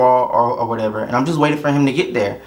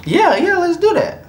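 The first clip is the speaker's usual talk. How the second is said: a sarcastic squeal of delight; mock eager. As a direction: jump at it in a high giddy voice.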